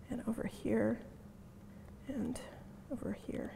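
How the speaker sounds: whispering while clicking around the screen. Real, not acted. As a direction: murmur it under your breath. Drops to whisper mid-demo.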